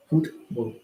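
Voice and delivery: a light British accent on Football. Shown as a demonstration. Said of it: "You gotta add the accent".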